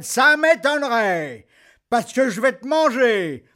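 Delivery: loud threatening troll voice. Acted. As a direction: menace like a hungry troll. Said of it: "dit le troll".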